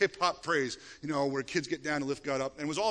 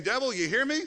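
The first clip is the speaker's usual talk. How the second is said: raised, higher challenge to the devil; taunting the devil, raised and high; defiant call to the devil; 7 semitones higher, 4 dB louder than usual. Acted. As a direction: call him out boldly. Preacher voicing defiance at devil.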